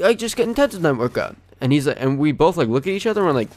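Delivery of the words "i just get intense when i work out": high-pitched put-on excuse; impression of older man. Accused gym member explaining his outburst, high-pitched impression. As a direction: mimic him making excuses in a raised voice. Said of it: "do my best impression of him".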